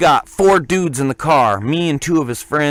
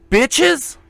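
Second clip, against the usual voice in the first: much higher questioning; mock puzzled question, much higher; 14 semitones higher than usual. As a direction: ask it in disbelief. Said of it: "And I go".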